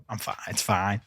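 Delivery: low, soft shrug-off; calm acceptance. Acted. Fan calmly taking the wind-up, calm acceptance. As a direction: brush it off quietly, unbothered. Acting the calm adult reaction.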